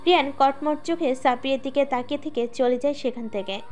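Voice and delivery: plain reading voice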